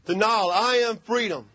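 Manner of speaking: usual speaking voice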